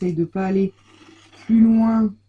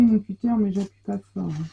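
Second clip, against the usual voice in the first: ordinary delivery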